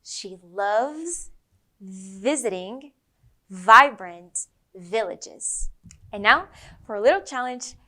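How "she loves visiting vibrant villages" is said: "She loves visiting vibrant villages" very slow, word by word, with buzzing V's; slow V sentence. Shown as a demonstration. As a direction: linger on each V. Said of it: "One more time".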